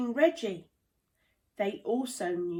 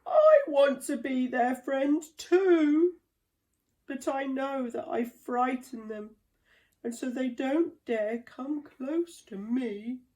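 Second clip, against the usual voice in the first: lonely rhino's plea; 4 semitones higher than usual. A narrator's reading. Reggie voiced, lonely and sad.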